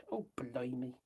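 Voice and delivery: whispered under the breath; whispered dismay. Real, not acted. Losing the video on the phone, whispered dismay.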